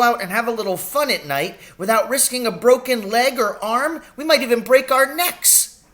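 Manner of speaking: high and loud, outraged tirade; furious ranting. A narrator's reading. Doctor raging against Victor Hazel's tiger traps. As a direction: rail against the villain. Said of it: "jumping up and down with fury".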